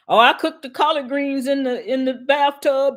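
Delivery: high, hammed-up Southern accent; mock Southern accent. Mocking a politician's Southern accent, high hammed-up Southern. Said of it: "she uses a Southern accent".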